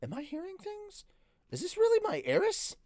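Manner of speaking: very high, squeaky disbelief; astonished. A narrator's reading. Hero doubting his own ears, astonished. Narrator disbelieving what he hears.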